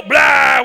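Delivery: loud sudden blah; demonstrated roar. Shown as a demonstration. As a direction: blurt out a big wordless yell. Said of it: "they just go".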